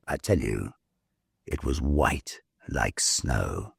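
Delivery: usual speaking voice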